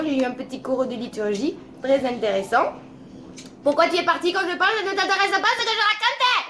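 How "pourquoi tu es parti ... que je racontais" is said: higher and fast, a hammed-up offended reproach; mock indignation. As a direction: playfully scold the one who walked off, pitched up and quick. Scolds a listener who left. (indignation jouée, aiguë et rapide)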